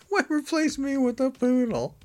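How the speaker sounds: high, whiny mock lament; plaintive wail. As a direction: wail it like a jilted mother. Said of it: "go like".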